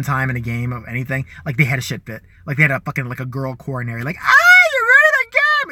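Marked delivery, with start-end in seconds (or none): 4.2-5.7 high, loud, slow whiny shout; mock tantrum yell. Mocking the jock boys losing it at games, high whiny yell. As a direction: ham up a sore loser's meltdown. Guys throwing a fit over game.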